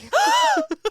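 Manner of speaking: sharp high gasp; big excited gasp; loud gasp. Retold or imitated. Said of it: "She was like".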